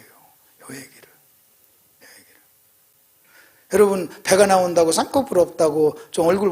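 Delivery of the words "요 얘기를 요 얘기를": very slow, fading whisper; hushed repetition. Real, not acted. Preacher letting the saying sink in, fading whisper. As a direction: let it fade slowly into a whisper.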